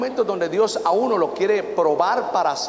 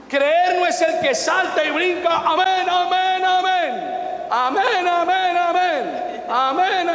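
mock shouting believer; 13 semitones higher, much slower than usual. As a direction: parody the jumping, shouting churchgoer. Mocks noisy jumping believers.

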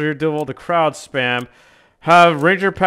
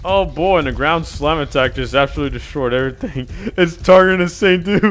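ordinary talk

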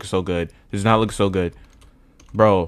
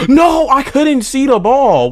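high, loud drawn-out outcry; real shout of protest; 11 dB louder, slower than usual. Real, not acted. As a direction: cry out in frustration at the game. Gamer reacting to losing the ball.

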